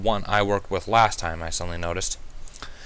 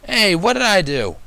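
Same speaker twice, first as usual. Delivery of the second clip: high, loud, slow, baffled little dragon; dragon protest; 9 semitones higher, 6 dB louder than usual. A narrator's reading. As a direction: plead innocence, confused. Spike protests innocence.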